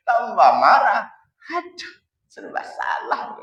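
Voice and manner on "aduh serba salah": whispered, slow sigh of defeat; mock despair. Husband who can't win with his wife, slow whispered mock despair. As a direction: groan it softly like a man who can't win.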